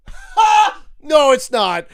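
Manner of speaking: loud, sharp laugh then shout of no; mocking shout. Real, not acted. Scoffing loudly at the fan's hope.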